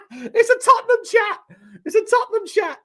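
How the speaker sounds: very high, squealed through laughter; cracking up while talking; real hysterical laughter. Real, not acted. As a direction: barely get it out for laughing. Cracking up at chat celebrating.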